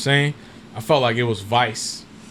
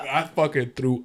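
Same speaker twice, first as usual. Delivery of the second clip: ordinary talk, nothing marked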